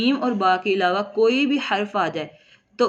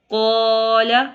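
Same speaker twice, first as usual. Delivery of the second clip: slow, drawn-out Quranic chant with held vowels; Quran recitation; 6 dB louder, much slower than usual. Shown as a demonstration. Teacher reciting the verse for students, slow tajweed chant. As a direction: recite it slowly like tilawat. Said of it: "teacher recites verse in tajweed".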